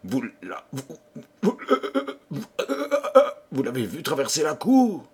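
stuttering on the first word in fright; stammer, stuck on the opening syllables; terrified stammer. A narrator's reading. The king asking who crossed the courtyard, stammering with terror. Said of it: "qui devint tout à coup bègue de terreur".

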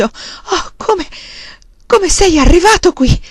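breath first, then high and loud; gasping disbelief. A narrator's reading. Woman embracing Charles, incredulous, gasping it out. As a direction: gasp out an astonished question. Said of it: "ansimò dopo un po'".

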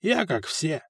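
soft, resigned agreement; subdued going along with the others; giving in. A narrator's reading. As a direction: give in quietly. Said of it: "сдался Фока".

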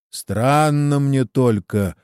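ordinary delivery, nothing marked

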